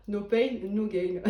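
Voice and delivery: lightly put-on English accent, tongue in cheek; mock English accent. Tarot reader jokes to her viewers in a light mock English accent. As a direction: say it with a jokey hint of an English accent. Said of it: "Superbe accent anglais".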